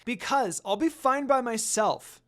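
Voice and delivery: put-on child defying the monk, loud and cross; angry retort. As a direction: dig in and refuse. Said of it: "Rin said with an angered look".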